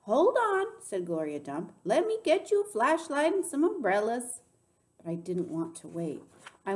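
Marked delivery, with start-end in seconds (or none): 0.0-0.7 high, loud call to stop; urgent raised voice. A narrator's reading. Gloria calls to stop the girl. 1.8-4.4 high, loud, urgent offer; raised, hurried-to-help voice; calling out. A narrator's reading. Gloria calls after the girl.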